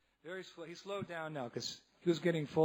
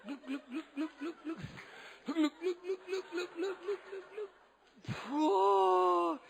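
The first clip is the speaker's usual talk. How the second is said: much higher, laughing glug glug; rising giggly gulping sounds; high glugging; 12 semitones higher, slower than usual. Retold or imitated. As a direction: gulp it down, squeaky and giddy. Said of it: "I don't know how you swallow it".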